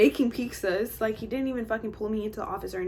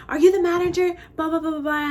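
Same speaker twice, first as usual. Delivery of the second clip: high, loud demanding imitation; mock yelling; 6 semitones higher, 6 dB louder than usual. Customer yelling at the owner, high mock demand. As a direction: imitate an irate customer confronting staff. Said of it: "yelling at the owner".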